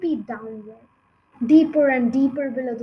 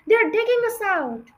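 high, loud cry of warning; alarmed shout; 9 semitones higher, 6 dB louder than usual. A narrator's reading. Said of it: "he shouted".

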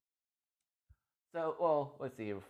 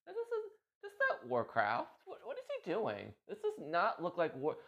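puzzled onlookers; 11 semitones higher than usual. Retold or imitated. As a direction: mimic confused players watching John. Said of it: "people were like".

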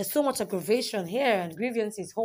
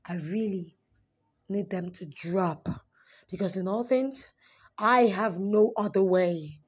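ordinary delivery

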